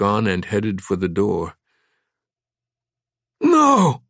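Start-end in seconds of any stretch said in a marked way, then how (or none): 3.4-4.0 high, loud shrieked No; woman shrieking as the machine restarts. Acted. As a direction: shriek it in agony. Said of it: "she shrieked".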